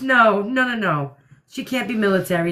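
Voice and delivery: usual speaking voice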